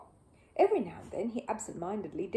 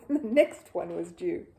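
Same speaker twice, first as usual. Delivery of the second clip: ordinary delivery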